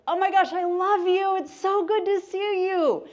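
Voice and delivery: high, loud excited gush; gushing greeting. Shown as a demonstration. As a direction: greet a friend with over-the-top joy. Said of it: "tone of voice, where someone says".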